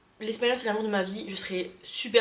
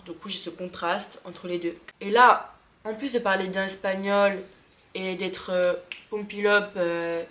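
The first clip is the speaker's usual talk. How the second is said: normal talking voice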